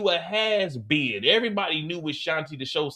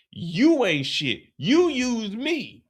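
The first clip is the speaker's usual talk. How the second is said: slow retort; 4 semitones higher, much slower than usual. Retold or imitated. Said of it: "Jannard say".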